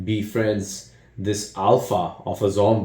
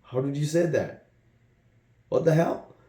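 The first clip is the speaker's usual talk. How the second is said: shocked; 5 semitones higher than usual. Retold or imitated.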